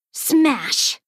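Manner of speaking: punchy sound-effect word; storybook smash. A narrator's reading. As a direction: bang out the crash. Guitar destroyed, sound word.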